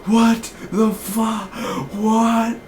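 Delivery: high, slow, stunned "what the fuck"; stunned disbelief. Retold or imitated. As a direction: drag out the shock in disbelief. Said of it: "And I'm like".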